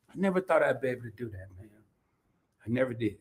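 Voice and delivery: ordinary talk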